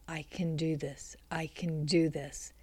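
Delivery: hushed, near-whispered mantra; murmured self-encouragement. A narrator's reading. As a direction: say it very softly to yourself to steady your nerves. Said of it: "repeating to myself".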